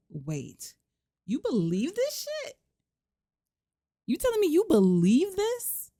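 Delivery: high, incredulous outraged squeal; outraged wife. Acted. Wife outraged at accusation, high incredulous shriek. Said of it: "So she is pissed".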